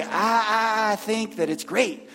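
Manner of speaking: mock southern drawl, vowels stretched out; Kurt's southern drawl. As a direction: imitate a coworker in a drawn-out southern accent. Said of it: "I do him in a southern drawl".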